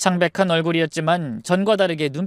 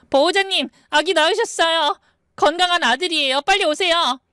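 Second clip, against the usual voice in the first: very high, urgent happy announcement; excited nurse; 11 semitones higher than usual. Acted. Nurse running to tell the guardian of a birth, excited. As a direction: call out the good news in a high voice.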